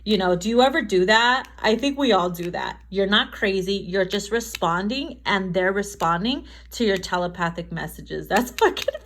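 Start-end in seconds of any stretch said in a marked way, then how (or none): none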